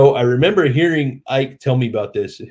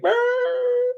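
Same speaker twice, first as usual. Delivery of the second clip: tiny terrified squeak, far higher; frightened squeak. Retold or imitated. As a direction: squeak out the fear he was hiding. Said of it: "I'm shaking like".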